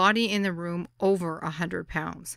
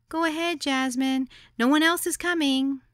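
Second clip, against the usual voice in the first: put-on high, polite girlish voice; sweetly polite, a little louder; polite young girl; 8 semitones higher, 3 dB louder than usual. A narrator's reading. Hostess telling the guest they're ready, high and polite.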